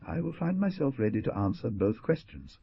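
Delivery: plain reading voice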